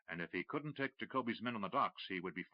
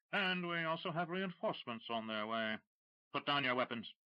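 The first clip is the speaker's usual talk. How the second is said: cool command; 6 semitones higher than usual. Acted. Dash calmly commanding smugglers.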